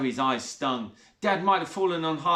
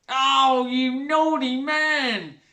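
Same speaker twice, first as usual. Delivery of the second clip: drawn-out, coy high flirting; flirty; 10 semitones higher, 6 dB louder, much slower than usual. A narrator's reading. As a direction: tease him, very slowly. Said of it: "flirted Zoe's stepmother".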